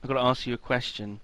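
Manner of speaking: ordinary talk, nothing marked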